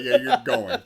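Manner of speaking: high, loud quote through laughter; mocking laughter. Soldiers laugh at the new guy's assignment, mocking laughter. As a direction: imitate soldiers laughing at the newcomer. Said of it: "Yeah, they, they laugh".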